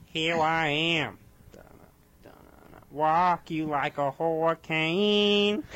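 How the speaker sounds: Walk for Rock, slow sung with w sounds; says w for r. Retold or imitated. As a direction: sing it slowly, saying w for r. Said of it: "I did it in Cleveland".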